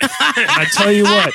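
loud, slow, laughing sales pitch; car salesman voice. Pretend. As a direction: sell it like a used car dealer. Mock salesman pitching wife.

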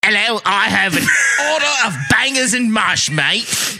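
high-pitched, hammed-up Cockney; squeaky mock Cockney, breaking into a laugh; Cockney accent. Shown as a demonstration. Ordering bangers and mash in a contest, over-the-top Cockney accent. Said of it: "has a bit of a Cockney accent".

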